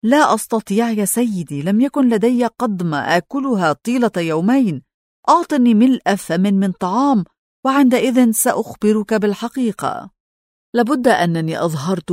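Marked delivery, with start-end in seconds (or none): none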